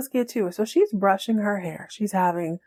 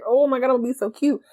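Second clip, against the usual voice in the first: fast, higher-pitched mock gushing; excited; 6 semitones higher, faster than usual. Model thrilled about her big night, excited. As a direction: rattle it off like an excited girlfriend. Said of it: "She's so excited".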